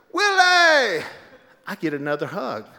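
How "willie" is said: high, loud delighted shout of the name; excited greeting. Retold or imitated. Obama spots Willie in the hallway, high loud greeting. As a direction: call his name in delight.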